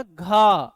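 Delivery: घा loud, breathy from the throat; sound demo. Shown as a demonstration. Teacher demonstrating the throat sound घा, loud. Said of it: "एक हमारा क्या हो गया?".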